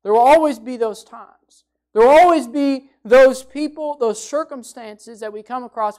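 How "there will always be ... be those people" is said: "always" pushed loud; louder "always" each time; stress on always. Shown as a demonstration. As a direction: hammer the word "always". Preacher builds emphasis.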